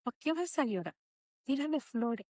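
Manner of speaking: soft inner voice; quiet voice from within. Retold or imitated. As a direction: hush it like a voice in your head. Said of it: "esa voz".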